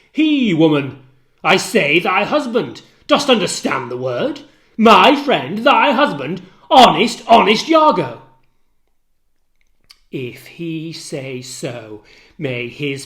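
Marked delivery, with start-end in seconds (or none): 0.1-8.2 loud, hammering repetition; furious insistence. Acted. Husband yells Iago's name at the maid, enraged. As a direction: pound each word at her.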